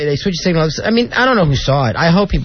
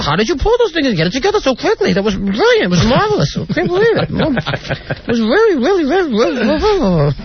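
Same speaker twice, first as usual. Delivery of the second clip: swooping, higher, hammed-up impersonation; Barbara Walters; 6 semitones higher than usual. Barbara gushing over the work, in a swooping mock voice. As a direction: stretch the gushing praise into caricature. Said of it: "Yeah, she was like".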